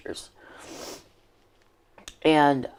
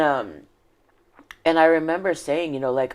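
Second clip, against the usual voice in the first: ordinary delivery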